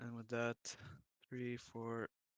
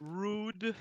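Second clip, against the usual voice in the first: mock offended; 10 semitones higher, 10 dB louder than usual. Real, not acted.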